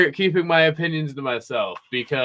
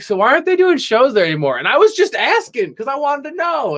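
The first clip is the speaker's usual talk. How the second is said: innocent question; 10 semitones higher than usual. Retold or imitated. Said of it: "I was like".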